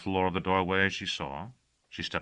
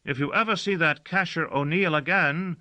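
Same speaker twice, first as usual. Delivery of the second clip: called out; 11 semitones higher, 4 dB louder than usual. Acted. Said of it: "called Gossagor".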